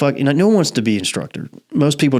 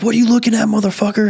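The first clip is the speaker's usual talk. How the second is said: mock angry yell; 10 semitones higher, faster than usual. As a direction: bark it like an angry sergeant. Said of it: "And he's like".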